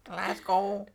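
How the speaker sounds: put-on Scottish Glasgow; Scottish accent. Shown as a demonstration. Trading Scottish accent attempts with a friend. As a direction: say Glasgow like a local Scot. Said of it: "to do a, a Scottish accent".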